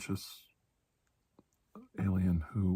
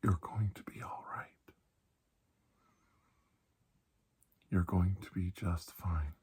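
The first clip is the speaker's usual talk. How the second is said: hushed, tender reassurance, partly whispered; reassuring. Acted. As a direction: soothe a frightened patient gently. Comforting the ill patient.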